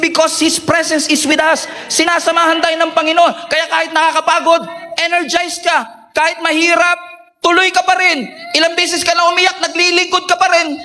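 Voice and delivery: high, driving preacher's build to a peak; rousing preaching. Real, not acted. Pastor rallying weary church volunteers, rousing high preaching.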